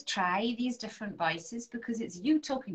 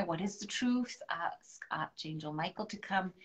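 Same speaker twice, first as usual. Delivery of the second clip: ordinary delivery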